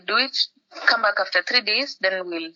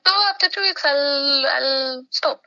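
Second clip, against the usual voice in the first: mock naive hope; 6 semitones higher, slower than usual. Naive plan to quit early.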